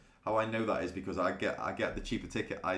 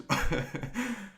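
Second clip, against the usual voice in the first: high laugh; 12 semitones higher, 4 dB louder than usual. Real, not acted. Laughs at chat joke.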